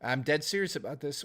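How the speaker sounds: ordinary talk, nothing marked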